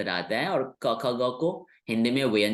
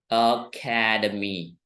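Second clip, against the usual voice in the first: Academy drawn out very slowly, every syllable clear; slow demo word; 3 semitones lower, much slower than usual. Shown as a demonstration. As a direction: stretch out academy so students hear each sound. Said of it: "ठीक वैसे ही". (Academy को बहुत धीरे-धीरे बोलकर दिखाना)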